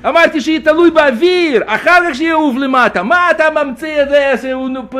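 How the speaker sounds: high, loud, furious yelling with bursts of laughter; angry shouting. Retold or imitated. Atheist friend yelling at the narrator over the falling rock, high and furious. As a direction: shout him down in outrage. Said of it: "אז הוא התעצבן עליי".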